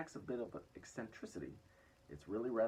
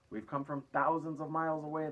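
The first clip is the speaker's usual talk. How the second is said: a cheeky, grinning shout, pitched higher; shouted back; 7 semitones higher, 8 dB louder than usual. A narrator's reading. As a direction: yell it back, enjoying the commotion. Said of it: "the centipede shouted back".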